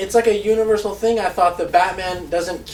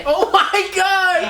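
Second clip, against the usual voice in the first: high, drawn-out disbelief; floored, squealing reaction; astonished outburst; 12 semitones higher, 3 dB louder, much slower than usual. Real, not acted. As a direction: stretch it out, pitched way up in amazement. Amazed by the friend's story.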